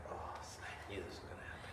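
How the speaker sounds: quick whispered aside to oneself; hushed aside. Retold or imitated. Storyteller's inner reaction to the crying nurse, hushed aside. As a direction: drop to a fast, very soft whisper.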